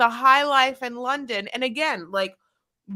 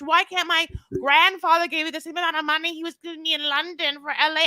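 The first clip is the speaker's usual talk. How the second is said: ordinary talk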